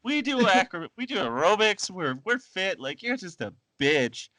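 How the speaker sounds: mock trash-talking grandma; sassy old lady. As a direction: talk trash like a gym granny. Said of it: "She's just like".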